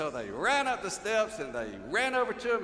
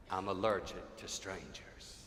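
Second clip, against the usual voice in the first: slow, lower, mocking snub; snooty little girl; 9 semitones lower, 4 dB quieter than usual. Little girl snubbing Georgia, slow mock-prim. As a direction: mimic a prim refusal.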